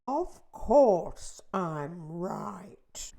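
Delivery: very slow, loud hushed drawl; smug gloomy earthworm. A narrator's reading. The earthworm gloats over the bad news, slowly. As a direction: savour being right about doom.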